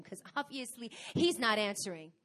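ordinary talk

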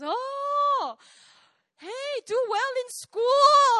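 high, loud, drawn-out cheery greeting; imitated jolly dad. Dad unexpectedly cheerful on the phone, imitated jolly voice. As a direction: boom it out as an overjoyed father. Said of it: "so happy and jolly".

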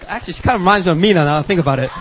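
usual speaking voice

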